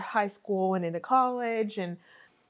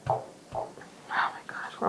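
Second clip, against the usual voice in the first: whispering; 6 dB quieter, much slower than usual. Real, not acted. Weary baker whispering to herself.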